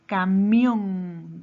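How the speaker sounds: camión loud, MIÓN drawn out; teacher's loud, held final syllable in camión; stress on MIÓN. Shown as a demonstration. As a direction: punch and stretch the MIÓN of camión. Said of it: "¿Dónde lleva la mayor fuerza de voz?".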